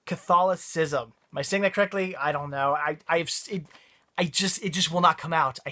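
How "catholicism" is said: CATHOLICISM slowly and carefully, stress on THOL; really slow. Shown as a demonstration. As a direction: take CATHOLICISM syllable by syllable. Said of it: "say it, like, really slow".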